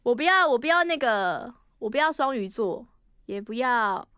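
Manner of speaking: ordinary talk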